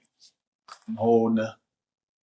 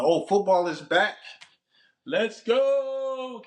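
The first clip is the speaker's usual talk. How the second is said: real excitement; 10 semitones higher, much slower than usual. Real, not acted. Fan greets football season's return, rising excited cheer. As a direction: voice soaring into a long high cheer.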